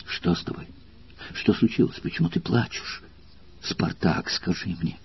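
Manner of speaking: frightened unvoiced whisper, voice breaking; anxious broken whisper. Valeria begs weeping Spartacus in a frightened whisper. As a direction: whisper in alarm, pleading. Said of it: "прерывающимся от тревоги голосом".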